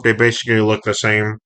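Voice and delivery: ordinary talk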